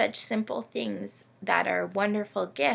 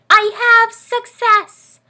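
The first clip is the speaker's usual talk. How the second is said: much higher and louder, slow, stretched out; triumphant cheer; 16 dB louder, slower than usual. A narrator's reading. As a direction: crow it like a proud little victory. Camel triumphs in story.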